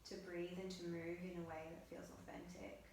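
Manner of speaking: soft, hushed meditation guidance; guided meditation. Acted. Yoga teacher guiding students through breath on their mats, soft meditative voice. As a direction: guide the class gently into breath awareness.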